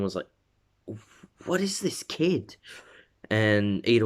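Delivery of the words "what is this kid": amazed. Retold or imitated. Crowd amazed at Deku.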